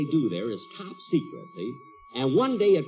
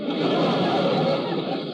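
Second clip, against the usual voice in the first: breathy laugh; 3 dB louder than usual. Acted. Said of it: "What are you laughing at".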